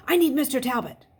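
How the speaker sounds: put-on loud urgent boy's plea. A narrator's reading. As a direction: press hard, it's urgent. Urgent phone call at night.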